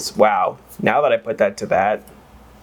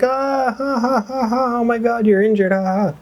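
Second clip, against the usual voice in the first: high mock cackling laugh, much higher voice; mom laughing at injury; 12 semitones higher than usual. Imitating mom laughing at injured kid, high cackle. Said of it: "she's like".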